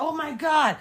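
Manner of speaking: high alarmed gasp; panicked. Retold or imitated. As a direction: blurt it in shock. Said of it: "We're like".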